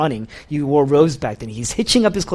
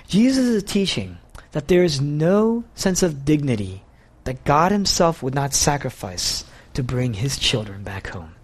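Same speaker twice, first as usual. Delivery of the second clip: ordinary delivery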